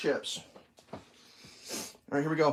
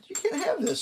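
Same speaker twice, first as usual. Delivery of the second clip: much higher, teasing no to the dog; high mock scolding. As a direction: refuse the begging dog in a squeaky voice. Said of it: "I'm like".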